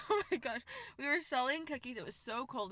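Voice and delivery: ordinary talk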